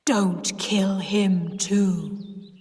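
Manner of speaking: very slow, ghostly deep voice. A narrator's reading. Sis warning her aunt down the rainpipe, deep voice. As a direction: draw it out like a haunting. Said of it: "she said in a deep voice".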